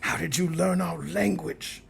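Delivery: movie quote. Retold or imitated. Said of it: "that was Thirteenth Warrior".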